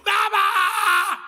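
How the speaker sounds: put-on child's tantrum in the shop, wailing. (cri d'enfant qui pleure, aigu et fort)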